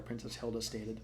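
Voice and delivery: ordinary delivery, nothing marked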